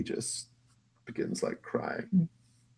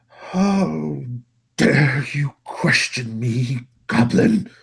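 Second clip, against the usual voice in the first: high, loud, slow outrage; indignant master; 10 semitones higher, 13 dB louder, much slower than usual. Acted. Master to goblin who questioned him, indignant. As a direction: rebuke a servant, loud and drawn out.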